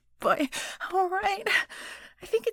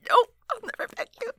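high, quick defiance; rushed, high-voiced protest; defiant refusal; 10 semitones higher, much faster than usual. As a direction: refuse fast, pitched high.

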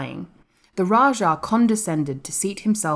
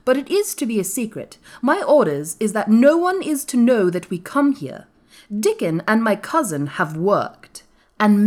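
higher, commanding proclamation; firm, imperious, voice raised; announcing; 5 semitones higher, 4 dB louder than usual. A narrator's reading. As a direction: give orders like a young master. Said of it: "announced Colin".

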